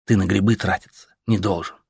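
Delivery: plain reading voice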